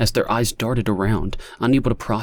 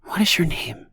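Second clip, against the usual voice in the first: whispered question; 10 semitones higher than usual. A narrator's reading. Lonnie asking the stranger his name, whispered. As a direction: barely whisper it. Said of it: "barely above a whisper".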